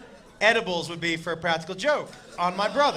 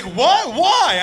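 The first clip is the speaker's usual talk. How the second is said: baffled; 7 semitones higher, 8 dB louder than usual. Retold or imitated. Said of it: "And he was like".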